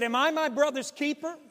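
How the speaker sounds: high, loud mocking retort; sarcastic Cain. Cain answering God, high and loudly sarcastic. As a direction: fling the question back scornfully. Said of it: "Cain was sarcastic with God".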